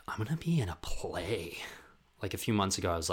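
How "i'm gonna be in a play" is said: whispered, slow and awed; breathy whisper of an ambitious plan; hushed. Retold or imitated. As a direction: whisper it like a big secret dream. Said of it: "I'll be like".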